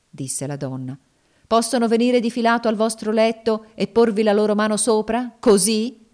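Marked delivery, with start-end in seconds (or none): 1.5-6.1 higher, louder insistent questioning; taunting slave woman, insistent. Acted. Slave woman unnerving her master, insistent and louder. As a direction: drive the eerie question home, louder. Cassie presses Legree with questions.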